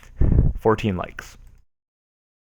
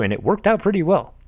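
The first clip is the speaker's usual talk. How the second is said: ordinary talk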